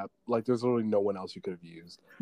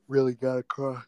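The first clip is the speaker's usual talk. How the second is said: normal talking voice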